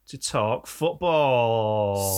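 very slow, announcing it; show segment intro. Acted. Host handing over to Anthony, very slow announcer intro.

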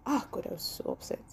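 whispered soft groan; quiet exasperation. Real, not acted. Host recalling her frustration, whispered and soft. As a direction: breathe it out, fed up.

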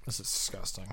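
hushed whisper of disgust. Real, not acted. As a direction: whisper it in revulsion. Tasting a disgusting milk.

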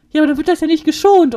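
fast, high and loud mock protest; Dylan's eager protest. Retelling Dylan's argument with the salesman, fast and loud.

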